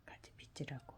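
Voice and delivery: soft breathy whisper. Real, not acted. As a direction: drop to a whisper. Quiet aside near dog.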